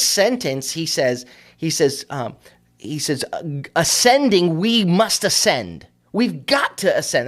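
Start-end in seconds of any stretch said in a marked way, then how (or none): 3.7-7.3 high, loud and slow, leaning on every "ascend"; emphatic Caleb. Acted. Preacher voicing Caleb urging the people up, emphatic and rousing. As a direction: drive home "ascending, we must ascend" like a rallying cry. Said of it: "two strongly emphatic phrases".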